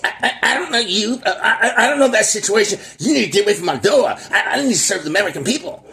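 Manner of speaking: high, far-off mocking imitation of a flustered senator; mimicking the senator. Mocking the senator who dodged Fox News questions, high shrill imitation. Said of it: "that's exactly how she sounded".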